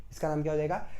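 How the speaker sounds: usual speaking voice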